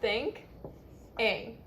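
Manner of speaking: "Think", a pause, then "Ing"; two separate syllables. Shown as a demonstration. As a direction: split "Think" from "Ing" for a learner. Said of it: "Two syllables".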